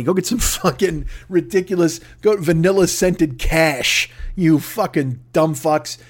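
ordinary talk, nothing marked

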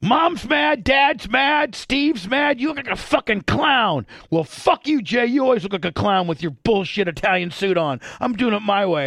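high mock yelling, easing slightly; retold screaming. Host acting out friends trading insults, mock screaming. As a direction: imitate both sides of a heated squabble. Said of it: "screaming at each other".

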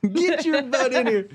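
high, slow Southern scolding; amused, high-pitched Southern holler; Southern mom. Acted. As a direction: order them inside in a Southern drawl. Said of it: "The Southern accent seems to be".

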